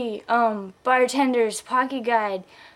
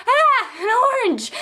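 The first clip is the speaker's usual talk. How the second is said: high, hammed-up startled cry; mock panic; 12 semitones higher, 3 dB louder than usual. Woman dodging an orange flung at her, mock panic. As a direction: yelp as if dodging it.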